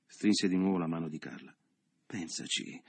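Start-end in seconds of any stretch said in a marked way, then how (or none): 2.1-2.8 urging quietly. A narrator's reading. Leo urging softly.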